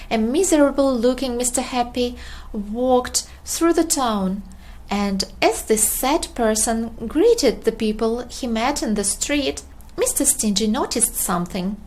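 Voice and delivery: usual speaking voice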